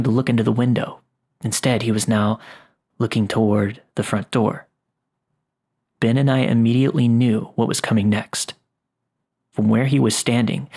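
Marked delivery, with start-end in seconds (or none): none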